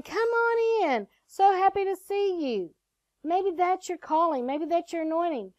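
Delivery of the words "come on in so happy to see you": high, drawn-out singsong welcome; slow, pitched-up friendly welcome; warm church greeter. Acted. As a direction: act a delighted greeter at the door. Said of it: "that warm person at that front door of the church".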